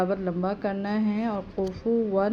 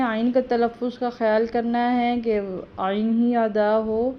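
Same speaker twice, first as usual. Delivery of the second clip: ordinary delivery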